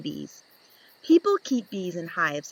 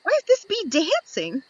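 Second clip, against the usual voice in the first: high squeaky bee voice; a tiny curious bee peeking out; little bee voice; 9 semitones higher, 3 dB louder than usual. Acted. Young bee asks the reader, high and curious.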